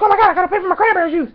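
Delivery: fast, high, loud blurting; overexcited panicky rush; amped up. Retold or imitated. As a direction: rush it out in a sudden fluster. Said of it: "amped up".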